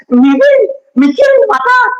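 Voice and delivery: put-on high, whimpering child's excuse; hammed-up high child whine; whiny son. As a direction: play a scared whining kid. Whining son in an acted dialogue.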